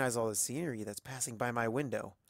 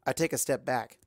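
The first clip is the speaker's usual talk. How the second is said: ordinary delivery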